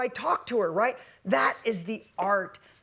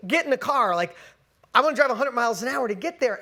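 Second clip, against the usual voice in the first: eager; 4 semitones higher, 7 dB louder, faster than usual. Retold or imitated. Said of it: "I'm like".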